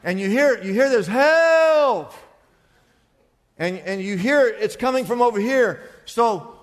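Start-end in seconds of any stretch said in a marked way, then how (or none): 1.0-2.1 loud, high, drawn-out holler; hollered cry for help. Acted. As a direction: act out a man sinking, yelling for rescue. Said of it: "he hollers at the top of his lungs".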